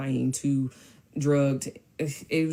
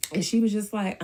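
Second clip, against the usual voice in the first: normal talking voice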